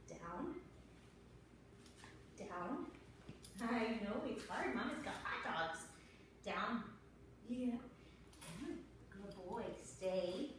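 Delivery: gentle praise and commands to a puppy; soft pet talk. Real, not acted. Trainer coaxing her red dog through tricks, soft and sing-song.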